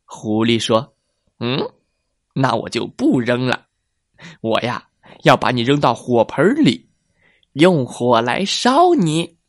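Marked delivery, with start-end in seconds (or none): 1.4-9.4 menacing fox. Acted. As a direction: growl out the fox's threat. Fox threatens again.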